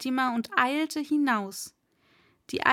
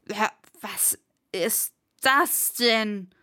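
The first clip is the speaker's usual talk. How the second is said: slow, spluttering outburst after a foul sip; disgusted cry; slower than usual. A narrator's reading. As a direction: choke out each word in disgust. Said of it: "schrie er und wischte sich angewidert". (angewiderter, stockender Ausruf)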